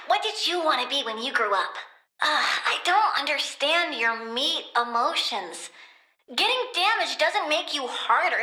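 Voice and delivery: usual speaking voice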